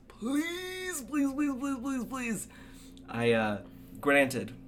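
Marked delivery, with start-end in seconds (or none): 0.1-2.5 very high, squeaky string of pleases; rapid high-pitched pleading; frantic begging. Real, not acted. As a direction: beg comically in a tiny high voice. Comic begging for quiet in theaters.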